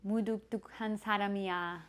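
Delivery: quick playful Korean. Shown as a demonstration. Trying out the new word.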